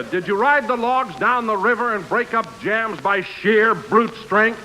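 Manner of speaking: normal talking voice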